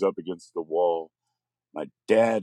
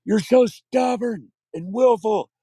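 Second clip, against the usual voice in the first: high, shrill mock scream, slowed; tearful screaming; 12 semitones higher, 4 dB louder than usual. Mother screaming at her son, high and bitter. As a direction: hurl it at the child in tears. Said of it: "screaming at me".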